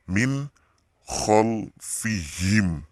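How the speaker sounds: MIN with clear n, then drawn-out KHOLFIHIM; clear recitation. Shown as a demonstration. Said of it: "nunnya jelas".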